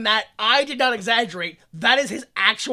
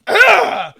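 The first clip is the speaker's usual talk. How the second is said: exasperated laughing yell; 10 semitones higher, 6 dB louder, slower than usual. Real, not acted. Exasperated at character.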